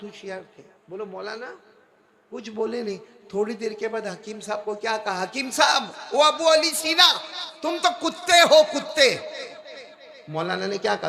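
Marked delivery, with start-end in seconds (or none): 5.2-9.2 loud, higher scornful shout of an insult. Retold or imitated. Maulana insulting the physician before the crowd, loud shout. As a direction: hurl the insult at him across the crowd.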